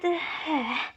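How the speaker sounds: long falling sigh; acted sigh. About to suggest teaming up, acted sigh. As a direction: breathe out a soft, reluctant sigh.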